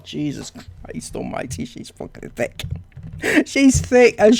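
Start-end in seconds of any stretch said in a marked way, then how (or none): 0.0-2.6 muttered aside. Real, not acted. Aside after reading comment.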